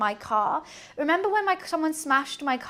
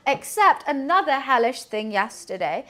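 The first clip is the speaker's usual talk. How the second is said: ordinary talk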